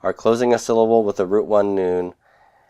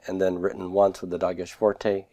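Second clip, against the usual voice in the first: ordinary talk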